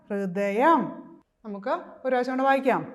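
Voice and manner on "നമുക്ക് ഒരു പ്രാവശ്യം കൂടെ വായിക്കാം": ordinary talk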